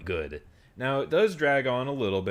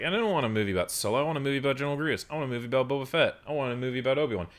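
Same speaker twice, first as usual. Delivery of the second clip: rapid complaint; 4 semitones higher, much faster than usual. Retold or imitated. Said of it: "I was like".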